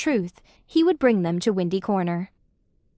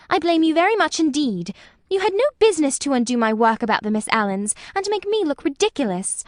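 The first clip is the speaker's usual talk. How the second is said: indignant scolding; 6 semitones higher than usual. A narrator's reading. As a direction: scold him, rising with offence. Lucy scolds Cecil.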